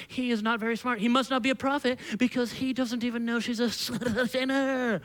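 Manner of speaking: put-on Pharisee disdain. Pharisee judging Jesus over the woman, mock Pharisee voice. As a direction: play a hammed-up Pharisee, drawing out the last word. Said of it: "And the Pharisee's like".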